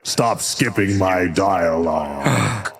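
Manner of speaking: slow, drawn-out villain complaint; annoyed villain. Acted. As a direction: scold the player for skipping.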